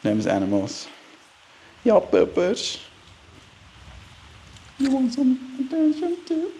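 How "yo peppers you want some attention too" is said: very high soft pet voice; pet talk. Real, not acted. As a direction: coo to the dog up high. Talking to a pet.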